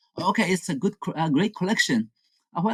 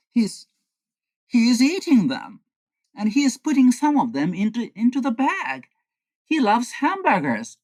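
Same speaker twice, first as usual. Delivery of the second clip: surprised; 8 semitones higher than usual. Acted. Peeking in, shocked at Henry.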